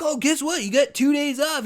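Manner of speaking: high, mocking singsong; sarcastically parroting the work week; mock cheery voice. Ranting vlogger mocks the weekend, high mocking voice.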